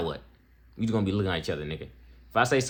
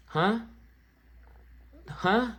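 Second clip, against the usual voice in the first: high, puzzled huh; mock confusion; 12 semitones higher than usual. Podcast host mocks someone mad at him for liking women, puzzled. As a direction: act baffled by the idea.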